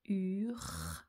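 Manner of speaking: "ur" with a long French u, then a held r; sons détachés à l'oreille. Shown as a demonstration. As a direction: draw out "ur", u first, r after. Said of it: "j'entends d'abord le U". (« ur » étiré, u puis r prolongé)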